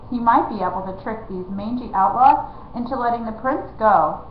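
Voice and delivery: plain reading voice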